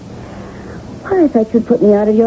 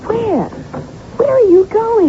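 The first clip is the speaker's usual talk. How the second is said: high, slow pleading question; a wife frightened he will walk out; alarmed; 12 semitones higher, much slower than usual. Acted. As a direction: hold him back with the question. Wife begs husband not to leave.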